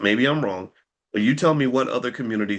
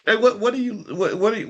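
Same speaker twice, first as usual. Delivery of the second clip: ordinary talk, nothing marked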